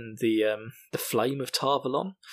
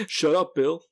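higher imitation of a friend's rebuke; Rich; 4 semitones higher, 4 dB louder, slower than usual. Host imagining a friend silencing him, mock rebuke. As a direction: playfully imitate being told off. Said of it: "I can imagine Rich saying that to me".

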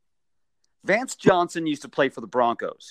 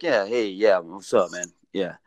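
brushing off; 6 semitones lower, slower than usual. Retold or imitated. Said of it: "kinda brushing me off".

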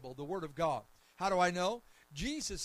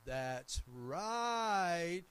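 drawn-out, swelling RIGHT in imitation of a church lady; put-on voice, stretching the last word long; church lady's approving shout; 4 dB louder than usual. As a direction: imitate a congregant calling out approval. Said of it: "that's exactly how she would say it".

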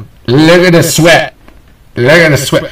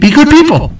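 catchphrase sign-off; 6 semitones higher than usual. Retold or imitated. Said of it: "as they say on Dead Meat".

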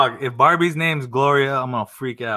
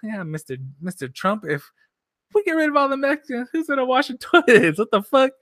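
higher, laughing mock-prim quote; 10 semitones higher than usual. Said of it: "She said that shit".

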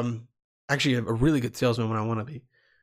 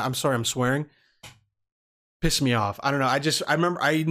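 normal talking voice

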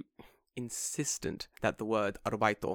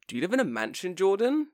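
higher, pointed question climbing on the name; mock insulting question; 8 semitones higher than usual. Imagined acquaintance needling Jordan about money, mock insulting question. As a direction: imitate a prying, leading question. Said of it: "I don't think she said it like that".

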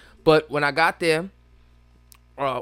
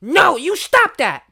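high mock scolding, pitched way up; scolding the bear; 11 semitones higher, 4 dB louder, slower than usual. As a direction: chide the bear like a pet owner. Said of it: "And he's like".

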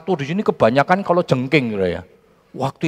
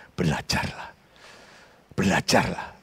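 soft urging; 6 semitones lower, 7 dB quieter than usual. Acted. Preacher urging softly.

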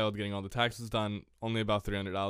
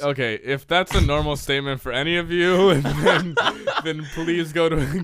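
loud, higher rant breaking into laughs; laughing incredulity; 5 semitones higher, 10 dB louder than usual. Real, not acted. Podcaster mocking a co-host's spending, laughing incredulity. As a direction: exclaim in disbelief, laughing through it.

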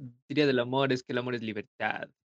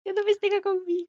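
mock heartbroken.

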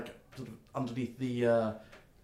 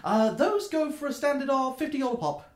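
much higher, eager question; chirpy gnome; 7 semitones higher, 6 dB louder than usual. Acted. Gnome checking the order with the customer, squeaky high. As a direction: ask it like a delighted shopkeeper.

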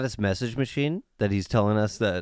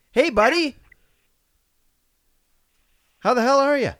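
phone speaker; 13 semitones higher, 7 dB louder than usual. Real, not acted. Caller heard over phone line.

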